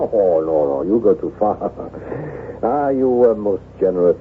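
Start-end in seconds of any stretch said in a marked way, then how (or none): 1.6-2.6 a soft, hushed chuckle; quiet embarrassed laugh. Acted. Told he looks handsome, a quiet embarrassed chuckle. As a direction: laugh it off softly, a little shy. Modestly brushing off a compliment.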